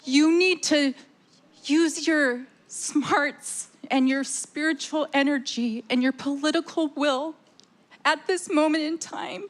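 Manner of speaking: ordinary talk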